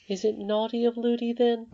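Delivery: put-on a little girl marvelling to herself; young Irene. A narrator's reading. Irene thinking about the old lady, light child voice.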